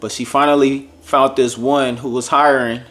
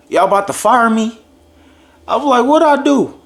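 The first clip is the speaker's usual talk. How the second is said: much higher, playful, half-laughing; joking nervous question; 10 semitones higher, 4 dB louder than usual. Retold or imitated. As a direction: tease the bosses while smiling. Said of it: "and I smiled and I said".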